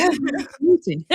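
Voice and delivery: Vladimir Putin in a hammed-up Russian accent. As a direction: imitate Steven Seagal's Russian accent. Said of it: "Steven Seagal saying Vladimir Putin with a Russian accent".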